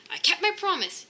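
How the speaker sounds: husband pleased with himself by the washer; proudly. Acted. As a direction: puff up with pride. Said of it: "he told himself proudly".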